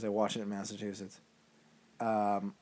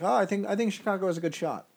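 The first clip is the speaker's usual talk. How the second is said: higher, louder mocking quote; mock analysts; 7 semitones higher, 5 dB louder than usual. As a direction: imitate pundits in a raised, hammy voice. Mocks analysts' predictions again.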